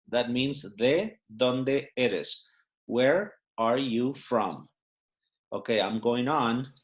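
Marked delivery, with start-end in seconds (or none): 0.8-2.5 ¿de dónde eres? said slowly, each word separate, de stressed; slow and spelled out. Shown as a demonstration. Teacher repeats the question slowly for beginners. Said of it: "they wrap it up into a single word".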